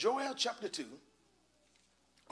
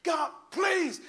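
raised, high-pitched desperate plea; retold anguished prayer, voice pitched up; crying out to God; 10 semitones higher, 4 dB louder, much slower than usual. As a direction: beg God to take it away, stretching the words. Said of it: "I'm crying and I'm hitting".

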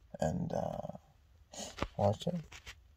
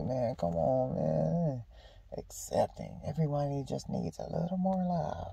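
mild hushed scoff; 2 semitones higher, 11 dB quieter than usual. Retold or imitated. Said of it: "Just being like".

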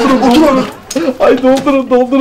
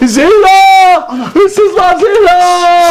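high, drawn-out shout for Zehra; staged alarm shout; 6 semitones higher, much slower than usual. Pretend. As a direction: shout a pretend burglary alarm. Fakes alarm to wake Zehra.

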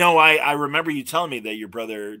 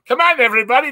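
much higher, loud, slowed polka-band emcee voice; John Candy's Schmenge voice; 9 dB louder than usual. Retold or imitated. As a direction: hype the crowd after a tuba solo like a goofy polka bandleader. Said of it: "John Candy in his schmeggy voice goes".

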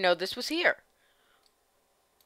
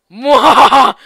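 evil laugh; 4 semitones higher, 15 dB louder than usual. Acted.

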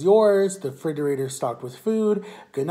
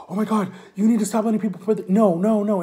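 higher, fast, exasperated imitation; retold pissed-off outburst; 7 semitones higher, 5 dB louder than usual. Ex-boyfriend fed up on the speaker's behalf, pissed off. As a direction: act out a fed-up friend, raised and hurried. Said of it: "he was, like, really pissed off".

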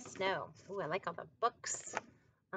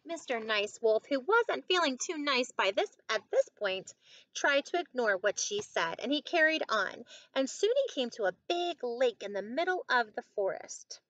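measured picture-book narration, fuller voice than the chat; story reading to children; 4 semitones higher, 8 dB louder than usual. A narrator's reading. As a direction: read it out clearly for young listeners.

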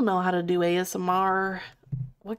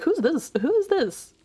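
high mock alarm; 8 semitones higher than usual. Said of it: "They're like".

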